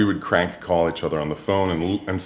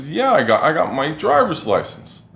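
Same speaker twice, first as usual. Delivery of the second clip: higher, earnest reply; meek younger self; 8 semitones higher than usual. Retold or imitated. Handing his license to a teasing friend, a bit higher and earnest. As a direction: answer meekly, taking it seriously. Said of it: "And I said".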